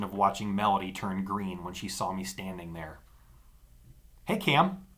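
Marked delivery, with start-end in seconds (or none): 4.2-4.8 nervous. A narrator's reading. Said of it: "she managed".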